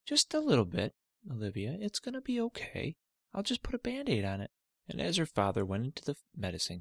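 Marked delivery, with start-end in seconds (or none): 0.1-4.5 lightly voiced, slightly higher soothing dad; dad calming his daughter. Father calming Olivia about her cut toe, gentle. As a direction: gently reassure the hurt girl.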